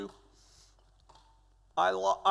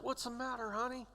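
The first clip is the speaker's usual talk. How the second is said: soft, higher-pitched tender question; concerned nurse; 5 semitones higher, 9 dB quieter than usual. Retold or imitated. Nurse asks scared patient what's wrong, softly concerned. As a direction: gently check on a frightened patient, hushed and high.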